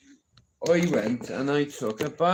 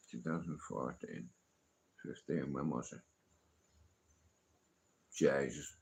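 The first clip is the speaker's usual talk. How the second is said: muttering to himself; 9 semitones lower, 11 dB quieter than usual. Real, not acted. Muttering to himself recalling year.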